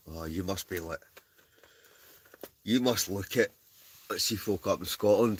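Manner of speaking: normal talking voice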